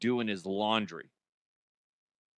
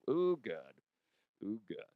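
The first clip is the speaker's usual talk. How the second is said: quiet disgusted groan; 3 semitones lower, 8 dB quieter than usual. Retold or imitated. Smelling the stinky chair.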